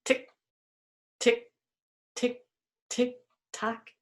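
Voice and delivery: spaced, crisp ticks; short ticks with even gaps; clock ticking. Shown as a demonstration. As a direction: tick evenly like a clock. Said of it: "What sound are you making?".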